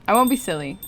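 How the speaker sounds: ordinary talk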